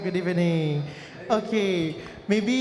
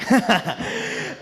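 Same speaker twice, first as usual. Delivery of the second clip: light laugh; 7 semitones higher than usual. Real, not acted. Host laughs after crowd answers.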